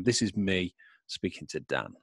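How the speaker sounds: ordinary delivery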